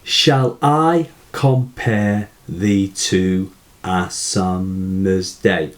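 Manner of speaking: slow, even syllable count; measured syllable-counting demo. Shown as a demonstration. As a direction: recite it slowly, checking off each syllable. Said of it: "So let's just check".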